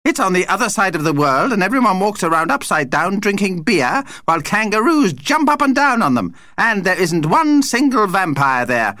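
high-pitched vampire rant; contemptuous. A narrator's reading. Vampire lists Australia's faults, contemptuous. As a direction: rattle off the complaints, pitched right up.

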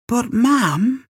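put-on high-pitched boy's protest to his mam; a child's "but Mum" plea; pleading protest. A narrator's reading.

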